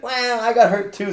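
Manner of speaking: put-on high, drawn-out childish whine; mock hurt child. Child who hurt another fishing for sympathy, mock whine. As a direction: imitate a kid faking hurt, loud and high. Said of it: "when a child gets in trouble, they pretend they're hurt".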